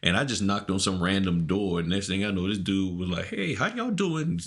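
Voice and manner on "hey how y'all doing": imitating a high British greeting; mock British accent, high and slow. Friend imitating an Australian host's greeting in a British accent. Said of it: "in his little British accent".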